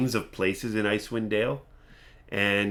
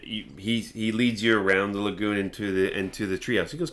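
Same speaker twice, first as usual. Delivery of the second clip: normal talking voice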